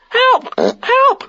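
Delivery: very high, squeaky cries for help; panicked cry. A narrator's reading. Piglet seeing the heffalump, panicked cry. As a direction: squeal in fright. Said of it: "cried Piglet".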